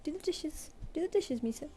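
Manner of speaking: high, bright sing-song command; high pet-command voice. Pretend. Owner pretending to order her cat, high sing-song.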